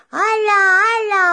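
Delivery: put-on high, loud gleeful hala from a kid on a swing; thrilled whoop, voice jumping up; excited cry. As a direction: shout it out with pure delight.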